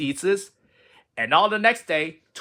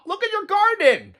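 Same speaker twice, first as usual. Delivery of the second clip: excited; 4 dB louder than usual. Acted. Frog excited.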